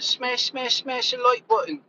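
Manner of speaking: high mock British accent. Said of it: "it should have been said with an accent".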